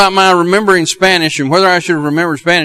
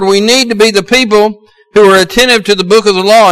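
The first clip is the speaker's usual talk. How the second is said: usual speaking voice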